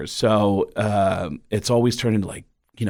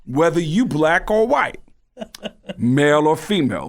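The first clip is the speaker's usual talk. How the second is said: Tracy Morgan; 5 semitones higher than usual. Acted. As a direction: draw it out in a put-on Bronx voice. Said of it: "sounds like Tracy Morgan".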